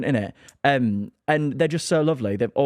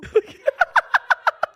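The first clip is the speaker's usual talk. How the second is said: sudden squealing high laugh; embarrassed laugh; 6 dB louder than usual. Real, not acted. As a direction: burst out in a shrieking laugh. Laughs after saying he's gorgeous.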